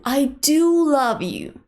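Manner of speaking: slow "I do love you" with "do" stressed. Shown as a demonstration. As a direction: lean on "do" to make them believe you. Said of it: "Notice that we stress the verb do".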